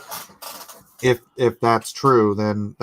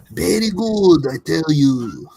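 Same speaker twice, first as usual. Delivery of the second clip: high put-on Japanese accent, playful; hammed-up Japanese accent; jokey fake Japanese accent; mock Japanese accent; 4 semitones higher, 3 dB louder than usual. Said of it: "I'm totally doing a Japanese accent".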